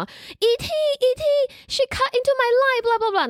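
put-on high, slow tattling child voice; a little kid arguing in line, high and drawn out; whiny kid. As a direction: whine it like a kid telling on a classmate. Kid complaining to a friend.

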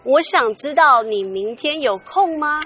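Chinese then straight into English; switching languages midsentence. Real, not acted. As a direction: flip from Mandarin to English mid-line. Host reads Chinese then asks.

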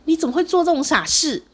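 grieving angry mother. Retold or imitated. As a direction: scold like a mother in shock and rage. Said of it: "很悲愤呢".

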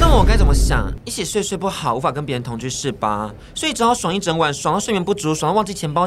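loud, high sarcastic accusation; jealous sarcasm. Acted. Ex mocks her after seeing the other man bring her wallet, jealous. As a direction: throw it at her, jealous and mocking.

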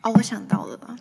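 恍然大悟，更高更响; 一下子想起来，兴奋地喊; 突然想起. Real, not acted. 忘词后突然想起. (想起话题时兴奋提高音量)

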